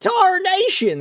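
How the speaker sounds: high, drawn-out cowboy holler; old cowboy voice. Pretend. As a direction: hoot it like an old-timey cowboy. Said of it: "I love being a cowboy".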